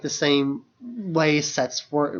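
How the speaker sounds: ordinary talk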